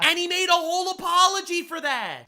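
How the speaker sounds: high, loud disbelief; incredulous. Real, not acted. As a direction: scoff in disbelief. Incredulous mocking.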